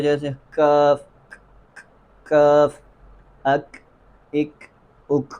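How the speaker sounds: "کاف" and "اک" with a puff of breath on the k; spaced model drill, k aspirated in "کاف" and "اک"; hams on Kaf. Shown as a demonstration. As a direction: release the k of "اک" with escaping air. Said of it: "کاف میں ہمس کی آواز پیدا ہوتی ہے".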